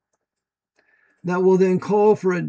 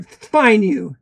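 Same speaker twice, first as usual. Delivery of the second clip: normal talking voice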